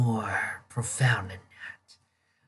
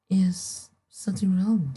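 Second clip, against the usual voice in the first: whisper; 7 semitones higher than usual. Acted. Eerie whispered voice.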